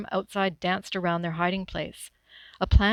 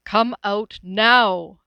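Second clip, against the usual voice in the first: very slow, loud megaphone command; megaphone demand; 10 dB louder, much slower than usual. Acted. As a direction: boom it through a megaphone. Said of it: "the magnified voice demanded".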